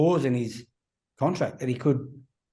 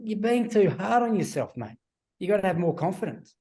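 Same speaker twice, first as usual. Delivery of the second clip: higher, lightly voiced coach telling him to ease up; mock reassuring coach; 6 semitones higher than usual. Coach calling the player after a game, lightly mock reassuring. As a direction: play the coach talking him up on the phone.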